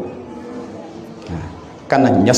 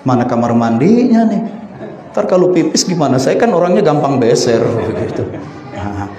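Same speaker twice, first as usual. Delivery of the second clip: ordinary delivery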